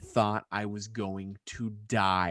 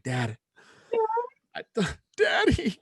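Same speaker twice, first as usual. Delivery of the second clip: higher, slow laughing whine; crying for his dad on the phone; mock crying; 5 dB louder, slower than usual. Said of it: "I'm like".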